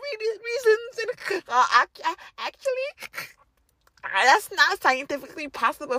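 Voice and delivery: high, prissy mock objection; mock nerdy sceptic. Mocking sceptics who say it's impossible, high prissy voice. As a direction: parody a know-it-all.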